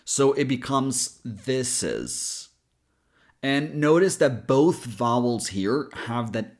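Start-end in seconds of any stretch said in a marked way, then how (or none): 1.2-2.5 "this is" run together, the s carried into "is"; linked words. Shown as a demonstration. Teacher shows how to connect words, "this is" linked smoothly. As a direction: slowly join "this is" as thi-sis, no split. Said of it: "bring the consonant to the next word".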